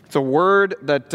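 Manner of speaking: ordinary talk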